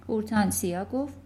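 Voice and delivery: ordinary delivery, nothing marked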